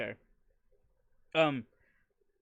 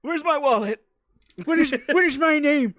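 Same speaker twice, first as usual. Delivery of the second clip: put-on old man voice; 11 semitones higher, 7 dB louder than usual.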